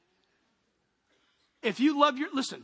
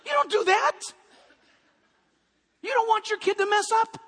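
high, loud, insistent preaching; emphatic shout; 12 semitones higher, 7 dB louder than usual. Real, not acted. Preacher to dads, shouting the point, loud and high. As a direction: drive the point home with a shout.

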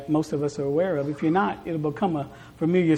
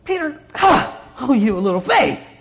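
mock disgust; 6 semitones higher, 5 dB louder than usual. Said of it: "It wasn't that disgust".

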